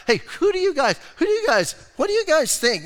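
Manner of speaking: high, bright teasing questions to the crowd; playful teasing Jesus. Retold or imitated. Jesus teasing the Pharisees with an easy question, high and playful. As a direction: coax them like a teacher with a trick question.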